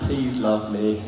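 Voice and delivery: loud, slow, laughing plea; mock plea. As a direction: beg it loudly through a laugh. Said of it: "They'd be like".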